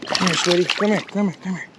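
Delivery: coaxing. Real, not acted. Coaxing the fish in.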